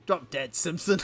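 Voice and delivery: loud, scornful drop dead; rude pirate retort. Acted. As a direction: spit it at him like a pirate. Pirates snapping at Simpson.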